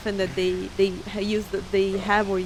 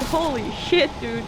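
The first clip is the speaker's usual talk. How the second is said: ordinary talk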